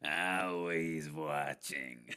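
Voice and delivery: slow drawn-out creepy Roz voice; slow, low, creaky repeat. Retold or imitated. Echoing Roz quote slowly.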